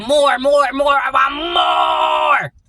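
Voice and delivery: loud, high greedy chant, stretching the last word; hammed-up greedy yell; greedy shouting. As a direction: shout it out, hungry and drawn out. Channeling someone's greed for more.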